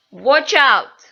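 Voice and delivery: loud, high warning; warning call. Shown as a demonstration. Teacher demos a warning, loud and high. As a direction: shout "watch out" with a rising end. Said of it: "intonasi meninggi".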